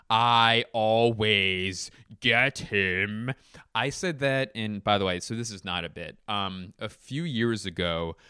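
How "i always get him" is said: extremely drawn out, every word stretched; milk each word of the boast; put-on voice, hugely elongated words; exaggerated slow gloat. Same boast repeated, stretched far slower.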